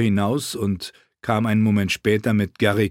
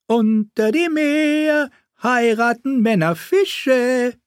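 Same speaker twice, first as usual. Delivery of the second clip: fool voice; 4 dB louder, slower than usual. Acted. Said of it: "Flickenfratz tänzelte herum".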